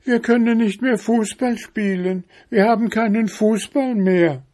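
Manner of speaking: very high bear-cub lament; wailing children. A narrator's reading. Cubs telling father their football is gone, high wailing. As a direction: wail the complaint in a little child's voice. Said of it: "riefen sie heulend".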